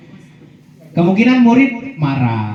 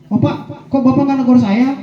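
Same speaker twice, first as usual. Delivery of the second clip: high, whiny student voice acting out a pupil; playful student complaint; 12 semitones higher than usual. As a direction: play a pupil teasingly asking why the teacher never scolds. Student complaining to teacher, acted. (suara murid merengek bernada tinggi)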